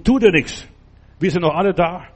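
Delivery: "Tu dir nichts" called out high and loud. Retold or imitated. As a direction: call out "Tu dir nichts" to the jailer. Wiederholt Paulus' Ruf laut. (lauter Ruf „Tu dir nichts“, hoch und kräftig)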